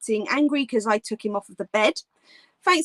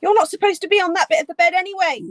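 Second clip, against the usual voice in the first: quick, high, louder mock telling-off; playful scolding; 6 semitones higher, 5 dB louder, much faster than usual. Real, not acted. Streamer to her dog on the bed, quick playful scolding.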